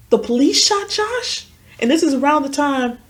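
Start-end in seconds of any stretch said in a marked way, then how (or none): 0.1-1.5 high, slow, rising disbelief; retold astonishment. Repeating news of the police shooting, astonished. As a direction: echo it back high, unable to believe it. Echoing shocking news back.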